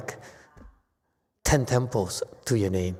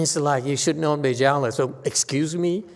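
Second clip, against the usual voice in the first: ordinary talk, nothing marked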